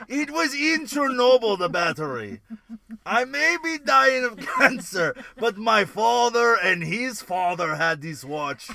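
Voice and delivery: loud, slow hammed Russian accent. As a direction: drawl it out as a proud Russian. Continuing Russian bit.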